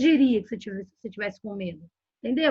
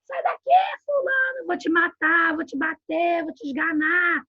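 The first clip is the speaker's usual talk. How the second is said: high-pitched mock parental outburst; acted crazy yelling; 5 semitones higher than usual. Parent losing control at a child, crazy yelling. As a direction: yell threats like a madman, hammed up. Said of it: "gritar igual louco".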